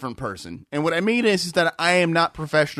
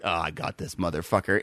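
low and soft, smugly knowing; lower, quieter, scheming aside; sly inner voice; 12 semitones lower, 6 dB quieter than usual. Retold or imitated. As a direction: her secret little victory, said low and soft. Voicing coworker's sly thought.

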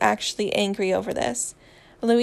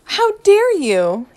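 high, loud, drawn-out affront; outraged gasp; 9 semitones higher, 10 dB louder, slower than usual. A narrator's reading. Harry is accused of cheating, loudly scandalized. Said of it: "Harry gasps".

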